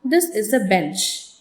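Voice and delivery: statement stepping down low onto "bench"; falling tone. Shown as a demonstration. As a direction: let the pitch fall away on "bench". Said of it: "falling tone".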